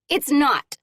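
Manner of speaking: loud, sharp comeback; angry retort. Acted. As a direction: snap it right back. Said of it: "Kendall shoots back".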